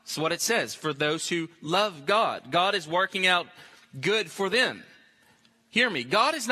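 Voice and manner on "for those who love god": ordinary talk, nothing marked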